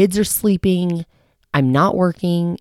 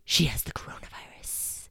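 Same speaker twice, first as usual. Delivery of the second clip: gasped whisper; 3 semitones lower, 13 dB quieter than usual. Retold or imitated. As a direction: gasp then whisper it in shock. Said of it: "a dirty look like".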